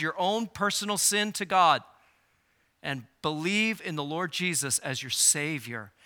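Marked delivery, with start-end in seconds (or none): none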